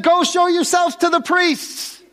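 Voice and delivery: high, carrying call across a distance; calling back. Retold or imitated. Jesus answers across distance.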